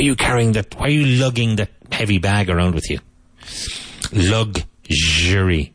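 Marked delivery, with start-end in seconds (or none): 4.1-5.7 Luxury said slowly, x as g plus zh; patient teacher model of Luxury; slow model word. Shown as a demonstration. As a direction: draw out Luxury: lug-zhuh-ree. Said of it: "You've got that strange zh".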